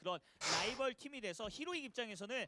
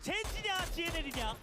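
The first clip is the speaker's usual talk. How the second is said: excited; 7 semitones higher, 4 dB louder than usual. Real, not acted. Caster hypes the decisive fight.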